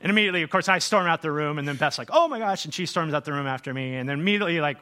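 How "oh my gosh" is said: high, loud mock dismay; shocked exclamation. Wife reacting as husband storms out, mock shocked. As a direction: imitate a shocked outburst.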